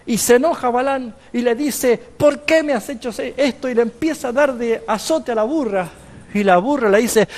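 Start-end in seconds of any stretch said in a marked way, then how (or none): 2.0-3.7 angry prophet. Acted. Angry prophet scolds donkey.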